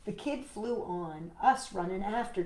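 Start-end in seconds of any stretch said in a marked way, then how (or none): none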